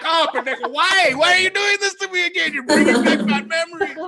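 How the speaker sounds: high-pitched, exasperated whine; mock anguished pleading. Dog owner reproaching the dog for scaring him, mock anguished plea. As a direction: plead in hammed-up distress.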